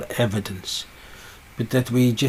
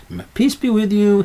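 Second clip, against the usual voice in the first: repeated greeting call; 9 semitones higher, 4 dB louder than usual. Retold or imitated. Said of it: "he'll say again".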